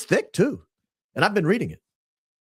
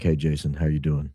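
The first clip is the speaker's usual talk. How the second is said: much lower, hoarse-sounding hello; low croaky greeting; 11 semitones lower than usual. Retold or imitated. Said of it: "talking like this the whole time".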